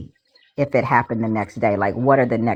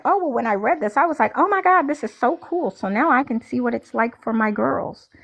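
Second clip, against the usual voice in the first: 1 gushing mock parent; 10 semitones higher than usual. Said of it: "some people started off saying".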